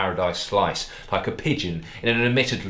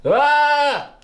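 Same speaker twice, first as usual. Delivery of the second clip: high, loud mock moan of anguish; hammed-up moan; 8 dB louder than usual. A narrator's reading. Darren suddenly in pain, loud high moan. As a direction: overplay a pained cry. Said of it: "moaned Darren".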